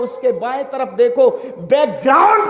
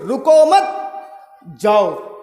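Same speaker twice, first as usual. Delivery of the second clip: रुको मत run together rising, a pause, then a lower जाओ; no break after रुको, stop after मत, then जाओ; 3 semitones lower, slower than usual. Shown as a demonstration. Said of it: "रुको के बाद मत रुकिए".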